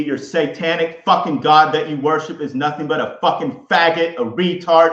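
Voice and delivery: loud, furious yelling at an unseen enemy; raging shout of defiance. Real, not acted. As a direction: scream the insults at him. Ranting furiously at Satan.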